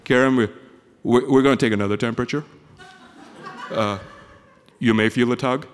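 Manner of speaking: lightly voiced low doctor; a hint of a measured clinician. Doctor warning patient about another temperature, lightly low and matter-of-fact. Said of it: "then the doctor comes in".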